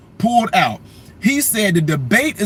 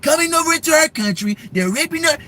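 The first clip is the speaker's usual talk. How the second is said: impersonating Trump attacking. Retold or imitated. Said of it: "Trump will be eating her up".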